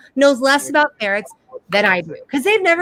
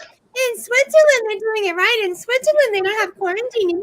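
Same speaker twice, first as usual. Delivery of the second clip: lofty mocking sing-song, much higher; snooty mock imitation; 10 semitones higher than usual. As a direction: mimic her smug praise of Switzerland. Imitating sister-in-law's boasting.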